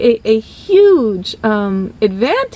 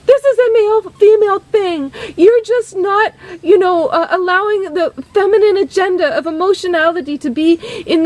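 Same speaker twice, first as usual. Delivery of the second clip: high, insistent argument; mock-indignant singer lecturing her producer; heated protest; 6 semitones higher than usual. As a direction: argue back, pitch raised, pushing the point. Singer arguing back in quarrel.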